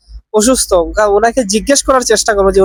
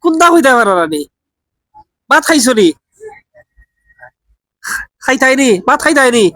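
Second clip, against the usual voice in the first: Sylheti Bengali questions, raised and patient; Sylheti dialect; 3 semitones higher than usual. Shown as a demonstration. To a confused old man, questioning him in Sylheti dialect. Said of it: "Sylheti ভাষা try করে দেখি".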